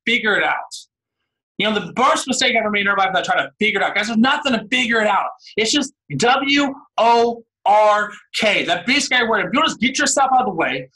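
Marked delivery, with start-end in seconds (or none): 5.6-8.6 W O R K spelled letter by letter, very slowly. Real, not acted. Motivator spelling out the word to his audience, slow deliberate letters. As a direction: drop each letter like a hammer blow.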